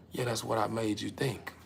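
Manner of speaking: ordinary talk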